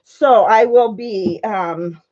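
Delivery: usual speaking voice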